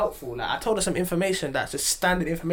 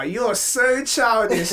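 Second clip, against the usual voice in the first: laughing; 6 semitones higher, 5 dB louder, much slower than usual. Retold or imitated. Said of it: "I just started laughing".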